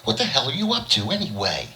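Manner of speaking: high, indignant demand; angry woman. A narrator's reading. Lil angrily confronts George after the slap, high pitched.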